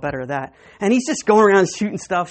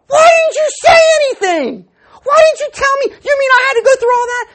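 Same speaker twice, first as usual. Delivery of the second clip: shouted much higher and louder in disbelief; outraged shout; 9 dB louder than usual. Retold or imitated. Boy to grandma who knew all along, shouting in disbelief. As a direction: yell it like it's all been for nothing.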